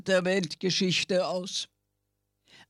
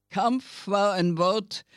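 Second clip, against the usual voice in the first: plain reading voice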